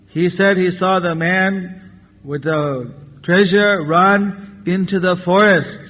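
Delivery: usual speaking voice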